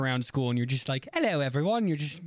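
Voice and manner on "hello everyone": mock British accent, pitched up; teasing imitation of a British accent. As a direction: put on a chirpy little British greeting. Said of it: "your little, uh, British accent".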